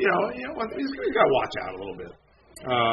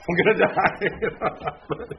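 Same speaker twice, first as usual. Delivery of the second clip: slow, drawn-out doom through a laugh; joking that he's doomed, laughing; laughing mock panic; 6 semitones higher, much slower than usual. Pastor recalling his reaction to the article, laughing mock panic.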